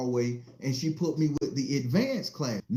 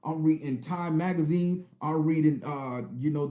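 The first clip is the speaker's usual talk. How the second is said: ordinary delivery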